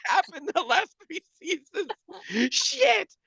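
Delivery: a mock panic as he realizes the recap is gone; dismayed realization. Telling a friend about skipping the recap, a high, dismayed mock panic.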